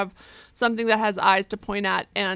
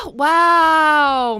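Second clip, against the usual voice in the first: shouted wow; loud outburst; 5 semitones higher, 12 dB louder than usual. Real, not acted. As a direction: yell out in outrage.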